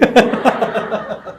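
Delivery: falling laugh. Real, not acted. Speaker laughing at his own robbery story, falling laugh. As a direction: let the laugh roll out and fall.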